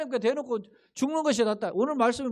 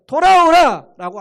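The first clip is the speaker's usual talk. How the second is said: higher, louder, slow calling out 돌아오라; God's call; 8 semitones higher, 14 dB louder, slower than usual. Retold or imitated. Preacher calling the congregation back as God would, loud and slow. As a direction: call out like God summoning sinners home.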